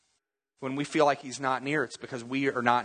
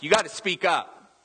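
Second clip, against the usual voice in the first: impatient demand; 4 semitones higher, 3 dB louder than usual. Acted. Acted impatient demand to a whisperer.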